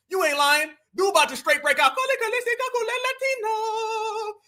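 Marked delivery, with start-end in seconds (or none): 1.9-4.3 high mock Spanish accent. Streamer pretending the chairman slips into Spanish, mock accent. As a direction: fake Spanish, much higher. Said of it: "this dude speaks Spanish".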